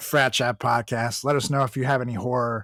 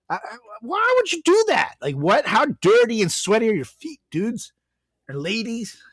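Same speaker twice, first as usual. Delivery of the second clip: mock disgust; 8 semitones higher than usual. Mocking barefoot passengers.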